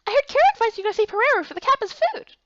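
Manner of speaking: high, light little-creature voice; small companion voice. Acted. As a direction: play the tiny friend, perky.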